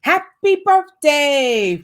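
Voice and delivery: slow, loud, high joyful cry; exclaimed. A narrator's reading. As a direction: stretch it out with delight. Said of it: "Frosty exclaimed".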